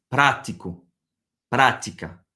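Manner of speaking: "prático" with stress on PRA; stress on first syllable. Shown as a demonstration. Teacher shows where the tonic accent falls, stressing PRA. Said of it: "là où il y a l'accent".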